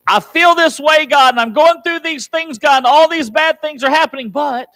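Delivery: loud, high-pitched plea; anguished cry to God. Acted. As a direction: cry out to God in acted desperation, building to a cut-off. Voices David crying out to God.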